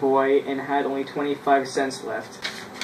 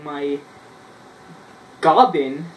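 ordinary talk

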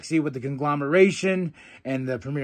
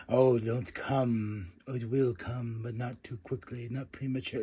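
mock hushed tease; 5 semitones lower, 8 dB quieter than usual. Imitating MXM's funny promo.

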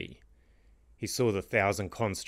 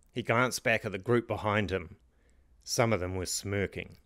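plain reading voice

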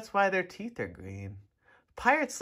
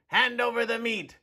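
higher, demanding bark; greedy pirate; 7 semitones higher than usual. A narrator's reading. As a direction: grab for the meat, mouth full. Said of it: "gulped his food and said".

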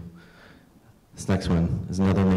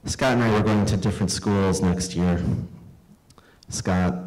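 normal talking voice

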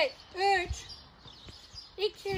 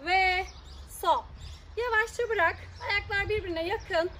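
usual speaking voice

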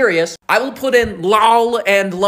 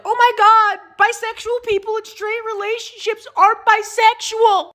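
much higher, whiny mocking tone. Mocking people who deny bisexuals, high whiny voice. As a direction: mimic the annoying tweeter. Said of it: "people are like".

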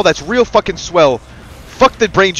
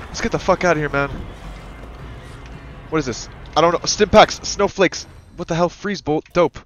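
ordinary talk

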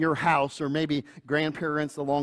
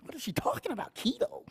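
high, soft, baffled falsetto; bewildered; 13 semitones higher, 7 dB quieter, faster than usual. Retold or imitated. Husband baffled by wife's keto meal, squeaky disbelief. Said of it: "I'm like".

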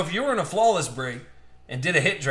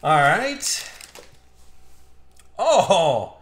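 ordinary talk, nothing marked

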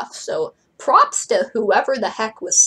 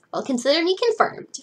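higher-pitched, smiling cheer; bright smile; 8 semitones higher than usual. Acted. Frankie happily confirms it to Lala, smiling brightly. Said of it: "Frankie smiled brightly".

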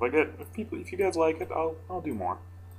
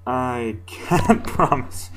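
laughing; 3 semitones higher, 4 dB louder, much slower than usual. Real, not acted.

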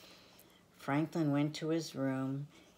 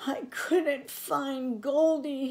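put-on high, slow, tearful child's voice; boy cries to his mother in a high voice; sobbing; 10 semitones higher, 7 dB louder, slower than usual. A narrator's reading. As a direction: wail it out through tears. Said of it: "he sobbed".

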